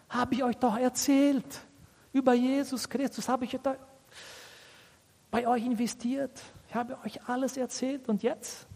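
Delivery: high, subdued pleading; disappointed. Acted. Paul reminding the Galatians what he invested, acted disappointment. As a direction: plead in disbelief, pitch up, holding back. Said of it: "Der war wirklich sehr, sehr enttäuscht".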